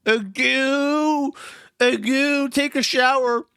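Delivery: put-on high, loud babbling baby with a pacifier. Shown as a demonstration. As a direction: coo it like a big baby sucking a pacifier. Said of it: "say it in a baby voice with a pacifier".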